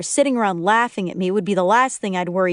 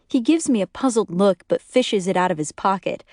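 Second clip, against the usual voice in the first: usual speaking voice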